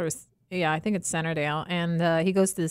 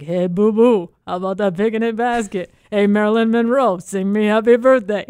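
loud cartoon bear voice; every impression booms out like the same cartoon bear; Yogi Bear; 7 dB louder than usual. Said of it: "His all sound like Yogi Bear".

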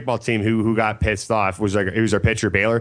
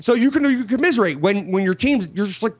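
ordinary talk, nothing marked

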